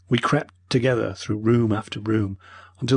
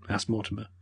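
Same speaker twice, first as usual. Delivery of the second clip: ordinary delivery, nothing marked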